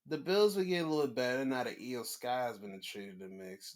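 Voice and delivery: normal talking voice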